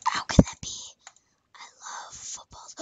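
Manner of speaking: whispered disbelief, no voice; whispering to yourself in dismay. Real, not acted. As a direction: hush it like a secret sorrow. Hushed dismay after outburst.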